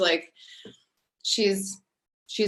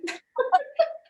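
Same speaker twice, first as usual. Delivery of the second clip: tearful laugh. Real, not acted. Overwhelmed thanks, laughing crying.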